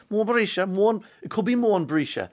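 Mon Brisha sung high in a West Cork lilt; West Cork accent. Shown as a demonstration. Presenter tries the flower name again in West Cork accent. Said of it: "in the accent so you can understand".

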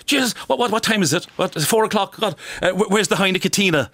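high, startled, just-woke-up voice; a dozy elderly father, lightly voiced; groggy old dad waking up. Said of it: "he said to my four little girls".

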